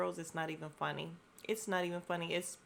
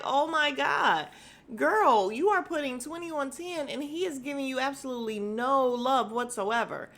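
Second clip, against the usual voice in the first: exasperated disbelief; 6 semitones higher, 5 dB louder than usual. Acted. Exasperated imagined telling-off of castmate.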